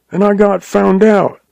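frightened blubbering confession. A narrator's reading. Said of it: "blubbered the agent".

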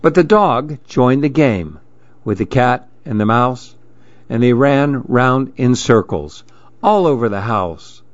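usual speaking voice

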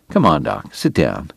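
low, soft coaxing; calm him down gently; gentle. A narrator's reading. Calming friend in a scene.

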